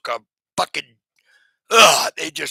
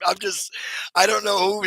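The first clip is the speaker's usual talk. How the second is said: ordinary talk, nothing marked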